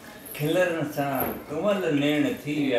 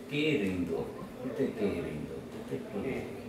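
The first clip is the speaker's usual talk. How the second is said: fading recital; 6 semitones lower, 9 dB quieter than usual. Acted. Poet fading recital of refrain.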